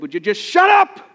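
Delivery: fed-up dad shouting at his son in the car; angry shout. Retold or imitated. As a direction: lose your temper and yell it. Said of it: "I yelled at him just like that".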